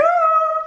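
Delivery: very high sustained "oh"; imitated howl. Imitating neighbour as he hears her, high howl. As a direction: mimic the singer's high wail. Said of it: "il fait".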